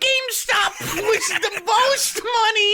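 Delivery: high, laughing put-on voice; mock Steve, cracking up; Steve impression. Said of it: "I could pretend to be Steve".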